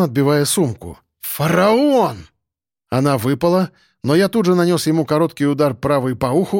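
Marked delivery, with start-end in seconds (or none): 1.2-2.3 roar. Acted. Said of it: "заревел он".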